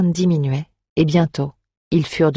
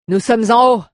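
crier vers le bas, plus aigu et fort; héler ses compagnons depuis une hauteur; appel au loin; 7 semitones higher, 6 dB louder than usual. A narrator's reading. (appel lancé à pleine voix)

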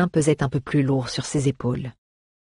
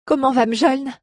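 voix étranglée; 9 semitones higher than usual. A narrator's reading. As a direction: question aiguë, légèrement étranglée. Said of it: "lança Aléa d'une voix étranglée". (jeune fille inquiète, voix étranglée et haute)

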